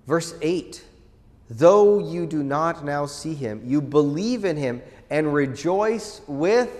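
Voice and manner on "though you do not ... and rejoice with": scripture reading. Acted.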